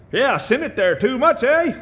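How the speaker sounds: put-on high Canadian accent, eh rising into a laugh; hammed-up Canadian, laugh on the eh; mock Canadian accent. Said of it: "I can't sound Canadian".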